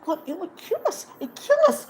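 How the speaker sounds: high, frantic creature plea; whispered Gollum. Acted. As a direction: gasp it out in fear, fast. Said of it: "whispered Gollum".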